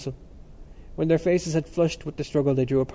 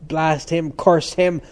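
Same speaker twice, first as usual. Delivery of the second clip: broad curse; 3 semitones higher, 7 dB louder, slower than usual. A narrator's reading. Cranley curses Temple to the others, broad and loud. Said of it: "said Cranley broadly".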